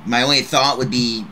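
normal talking voice

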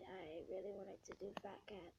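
whisper. Real, not acted.

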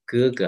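哥哥 with strong first syllable, light second; neutral-tone demo. Shown as a demonstration. Teacher models family words, 哥哥 ending in neutral tone. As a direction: lean on the first 哥, drop the second. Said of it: "the neutral tone".

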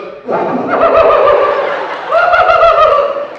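high, loud cackle of laughter; imitating Eve's giggle fit; high cackling laugh. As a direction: burst into a shrieking laugh. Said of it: "and she goes".

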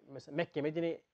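ordinary talk

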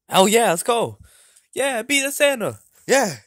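loud, high hyped-up cheer; rally your buddy for a fight; excited rallying. Acted. Hyping Mario to beat up Santa, excited.

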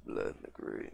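hushed creepy echo of the phrase; whispered echo. Acted. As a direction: whisper it back to yourself. Repeats phrase, second take whispered.

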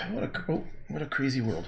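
ordinary talk

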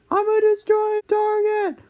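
high, squeaky grumpy dad; whiny high-pitched old man rant; cranky old dad voice. Acted. Dad puppet voice throughout.

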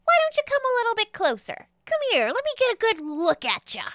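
very high pixie character voice; Jeremy the pixie. Acted. Pixie beckons a newcomer closer, high-pitched and inviting. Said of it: "he says".

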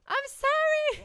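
loud apology. Real, not acted. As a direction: blurt the apology out high and slow. Repeated apology turns loud.

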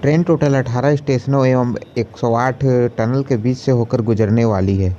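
normal talking voice

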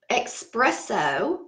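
"espresso" said slowly as "ekspresso", with an x sound; country pronunciation. Shown as a demonstration. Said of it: "I say ex".

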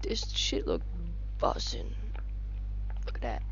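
hushed whisper of the phrase; quiet whisper take. Real, not acted. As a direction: whisper this shit look bussin'.

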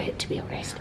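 breathy whispered aside. Real, not acted.

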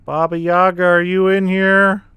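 loud, slow, rising call. Pretend. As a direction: call into the room for the witch. Calling out to the witch.

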